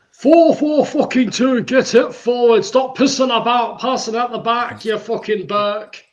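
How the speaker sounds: put-on loud, high ranting English accent. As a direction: bellow it like an angry English fan. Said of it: "Do you wanna say that in an English accent".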